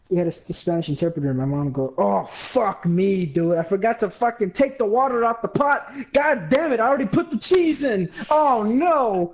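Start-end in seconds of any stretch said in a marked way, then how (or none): 2.0-9.3 high-pitched, exasperated cursing; real dismayed outburst. Real, not acted. Storyteller realizes he ruined his cooking, real exasperated dismay. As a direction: wail in frustration at your own blunder.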